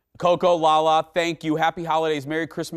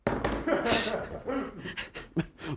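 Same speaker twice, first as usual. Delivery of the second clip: long, high, soft laugh; helpless high laughter, trailing on; a drawn-out real laugh; 10 semitones higher, 7 dB quieter than usual. Real, not acted. Laughs at the viewer's comment.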